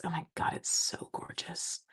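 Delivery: soft unvoiced gasp of delight; awed whisper. Real, not acted. Crafter whispering in awe at the finished card.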